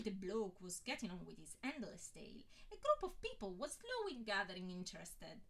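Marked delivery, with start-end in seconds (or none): none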